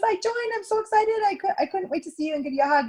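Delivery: high, bubbly imitated excitement; excited hostess. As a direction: gush it out, thrilled to share news.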